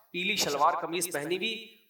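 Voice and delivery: ordinary delivery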